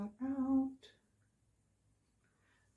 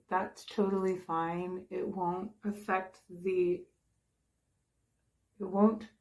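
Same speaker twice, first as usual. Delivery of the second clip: ordinary talk, nothing marked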